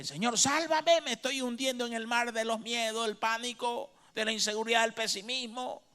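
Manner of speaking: high, loud desperate plea; a frightened believer crying out in prayer; pleading prayer. Acted. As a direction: beg the Lord to save you from sinking.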